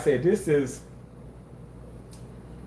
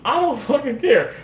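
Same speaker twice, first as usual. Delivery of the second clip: emphatic; 10 semitones higher, 3 dB louder than usual. Real, not acted. Repeats it, much higher.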